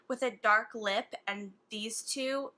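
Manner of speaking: ordinary talk, nothing marked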